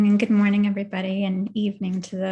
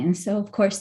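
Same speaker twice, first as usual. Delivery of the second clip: normal talking voice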